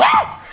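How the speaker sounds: shrill high shriek; mock startled scream; retold scream. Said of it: "She screamed so loud".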